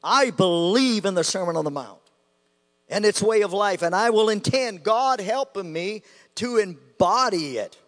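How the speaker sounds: loud, declaring creed; with conviction. Shown as a demonstration. Preacher recites the creed to his congregation, loud conviction. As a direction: proclaim it like a vow before God. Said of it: "each time with conviction".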